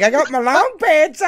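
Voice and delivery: teasing a friend about his long pants; mocking Mark. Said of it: "Mark's like".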